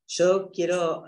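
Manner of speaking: ordinary delivery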